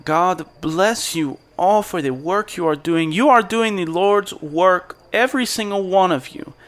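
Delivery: high, lifted preacher's blessing; Caspian's benediction. Acted. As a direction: bless the workers from up high in pitch. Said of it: "Caspian Marin says".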